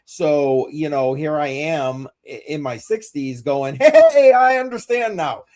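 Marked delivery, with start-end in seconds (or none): 3.8-5.4 high, loud mock exclamation; mock eureka. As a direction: exclaim like a sudden discovery.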